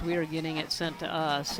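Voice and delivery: usual speaking voice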